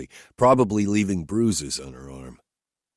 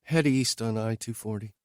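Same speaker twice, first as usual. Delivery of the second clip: higher, lightly voiced woman asking bluntly; higher woman's voice, questioning her driver; Whitney's voice; 5 semitones higher, 3 dB quieter than usual. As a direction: lift into her voice, direct and searching.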